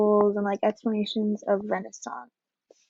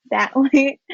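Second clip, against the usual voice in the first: laughing; 3 semitones higher, 6 dB louder than usual. Real, not acted. Giggling mid explanation.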